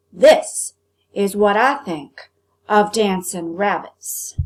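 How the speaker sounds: loud, slow, heavy threat; menacing cook. Acted. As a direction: spit out each word before the blow. Cook about to smash rabbit.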